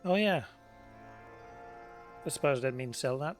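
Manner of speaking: ordinary delivery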